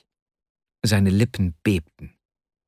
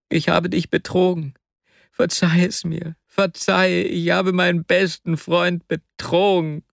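put-on higher, anguished young man confessing; Wasja in anguish; 8 semitones higher than usual. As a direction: plead guilty with a strained high voice.

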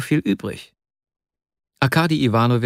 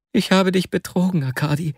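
put-on higher, anguished young man confessing; Wasja in anguish; 9 semitones higher than usual. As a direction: plead guilty with a strained high voice.

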